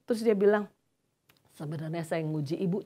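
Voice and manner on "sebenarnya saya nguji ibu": quiet retelling. Retold or imitated.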